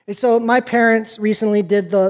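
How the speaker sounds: normal talking voice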